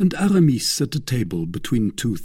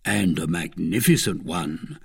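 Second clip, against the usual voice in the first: usual speaking voice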